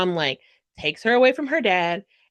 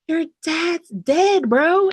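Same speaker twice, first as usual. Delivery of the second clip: higher, louder, slow mock announcement; drawn-out blurt; 8 semitones higher, 4 dB louder, much slower than usual. James's dad telling Wyatt bluntly, slow mock blurt. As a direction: drag out the bad news loudly. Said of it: "and is like".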